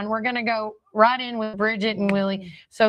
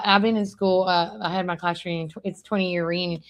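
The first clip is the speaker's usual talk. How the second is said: ordinary talk, nothing marked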